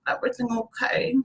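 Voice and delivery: a hint of a tiny child checking in, hushed; lightly imitated little-kid voice, very soft. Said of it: "a little tiny voice that was like".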